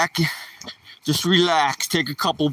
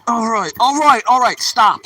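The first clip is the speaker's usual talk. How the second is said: high, loud all right and stop to the dog; loud, rising alarm to hold the dog back; firm loud command; 7 semitones higher, 5 dB louder than usual. Real, not acted. Owner fending off a jumping dog, loud and high.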